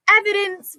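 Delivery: high, bright shout of the word; loud eager yell; excited yell. A narrator's reading. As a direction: call it out together, thrilled. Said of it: "we both yelled at the same time".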